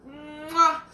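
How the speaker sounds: loud, high drawn-out "mmm-wah" kiss; blown kiss. Real, not acted. Author signing off to viewers, big blown kiss. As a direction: hum up high into a smacked kiss.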